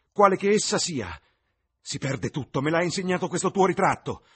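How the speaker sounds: irritated, heated. Acted. Dorian, il volto acceso, accusa Basil con rabbia. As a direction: spit out wounded jealousy, rising. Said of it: "Sembrava molto irritato".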